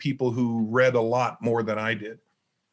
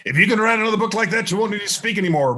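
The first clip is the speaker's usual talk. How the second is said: higher, louder, fast and thrilled; excited mentor; 6 semitones higher, 4 dB louder, faster than usual. Retold or imitated. Mentor phoning about the manuscript, excited fast praise. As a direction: rattle it off like a mentor bursting with praise. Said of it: "actually, here's how he said it".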